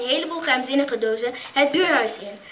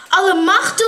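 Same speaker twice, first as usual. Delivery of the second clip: exclamation; 4 semitones higher, 11 dB louder than usual. Acted. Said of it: "roept ze uit".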